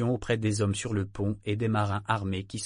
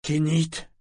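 mildly moaned name, slightly raised; incredulous moan; 4 semitones higher than usual. A narrator's reading. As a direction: protest in understated disbelief. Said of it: "gémit Sorkor d'un ton incrédule". (gémissement incrédule, contenu)

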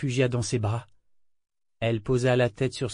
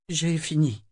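broken voice; 5 semitones higher, slower than usual. A narrator's reading. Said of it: "dit-elle d'une voix cassée".

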